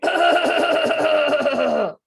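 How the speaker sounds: loud rasping crocodile hack; loud croc throat noise; loud throaty hacking noise. Shown as a demonstration. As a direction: clear the throat hard like a croc. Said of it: "and then make a noise".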